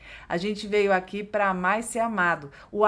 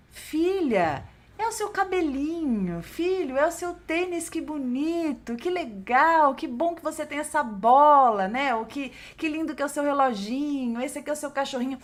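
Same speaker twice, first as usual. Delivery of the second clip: higher, tender praising voice to a little one; affectionate parent; 6 semitones higher than usual. Retold or imitated. Parent praising child's hair and shoes, tenderly. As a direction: coo over the child's things with love. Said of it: "E quando você diz".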